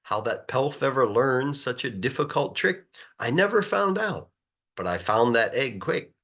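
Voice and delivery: ordinary delivery, nothing marked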